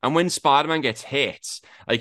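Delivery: ordinary talk, nothing marked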